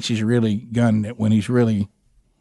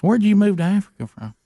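imitating Gary, higher and halting; Gary's voice; 7 semitones higher than usual. Gary unsure whether the visitors are African, higher imitated voice. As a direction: stammer it out in a higher put-on voice.

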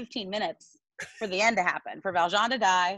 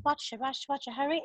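low-energy imitation; 6 semitones higher than usual. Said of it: "there's no energy behind anything".

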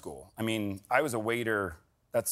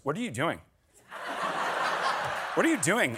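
lightly voiced indignant kid, pitched up; child scolding the screen; 5 semitones higher than usual. Daughter talking back to the TV, lightly mimicked indignation. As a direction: imitate a child telling off the TV. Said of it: "she's talking to the screen".

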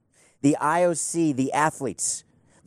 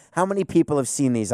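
ordinary delivery

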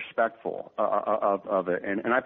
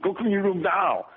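stern tough-guy order, raised pitch, slow; hammed-up tough-guy dad laying down the law; 7 semitones higher than usual. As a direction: bark it like an action hero father. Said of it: "I do the Arnold, I do Clint".

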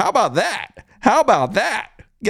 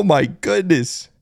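usual speaking voice

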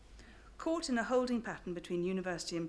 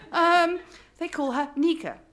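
desperate English tones; 9 semitones higher, 11 dB louder than usual. Retold or imitated. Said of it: "desperate English tones".

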